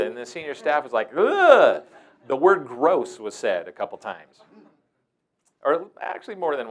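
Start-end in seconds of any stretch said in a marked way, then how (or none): 1.2-1.8 loud, high groan of disgust; disgusted ugh. Retold or imitated. Senior staff reacting to the girl, loud disgusted groan. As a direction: groan like you can't bear to look.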